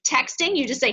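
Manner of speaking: ordinary delivery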